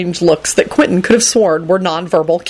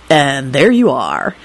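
slow, lowered, smug drawl; 4 semitones lower, slower than usual. A narrator's reading. Henry to Jamie, smugly. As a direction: say it slowly, point proven. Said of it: "Henry said smugly".

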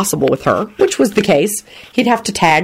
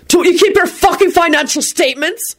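shouted; 13 semitones higher than usual. A narrator's reading. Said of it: "he shouted".

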